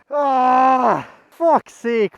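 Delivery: loud, pained shout of frustration; frustrated yell. Real, not acted. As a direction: blurt it out in real anger. Frustrated outburst at loose part.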